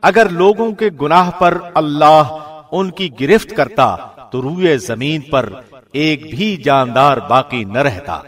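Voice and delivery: usual speaking voice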